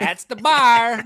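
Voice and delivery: put-on slow, loud Michigan accent on bar; imitating Michigan accent. Cohost echoing the Michigan woman from the clip, mock Michigan accent. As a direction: echo the clip lady's Michigan accent, drawn out. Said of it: "that's definitely a Michigan accent".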